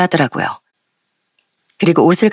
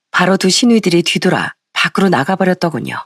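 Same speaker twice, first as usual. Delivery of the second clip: ordinary delivery, nothing marked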